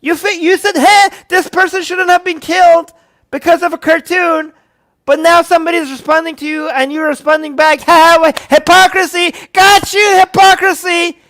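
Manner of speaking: very high, loud, sneering taunt; mock the critics' gotcha. Parodying critics taunting Macron, high and sneering.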